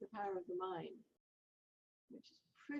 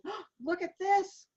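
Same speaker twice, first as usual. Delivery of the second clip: higher, louder, drawn-out gasp of amazement; imitating excited doctors; 10 semitones higher, 11 dB louder, slower than usual. Doctor rushing in with her x-rays, imitated excitement. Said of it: "doctors rush into the room".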